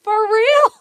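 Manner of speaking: high and loud, close to tears; almost crying from the surprise; high, loud, teary-voiced disbelief; overwhelmed disbelief. Real, not acted. Reacting to surprise gift.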